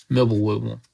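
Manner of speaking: ordinary delivery, nothing marked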